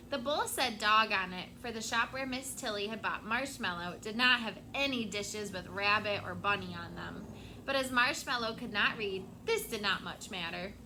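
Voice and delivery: ordinary delivery, nothing marked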